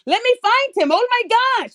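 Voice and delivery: high, sarcastic mock surprise; mock gasp. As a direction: pretend to be a gullible finder. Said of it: "do you honestly think they're gonna".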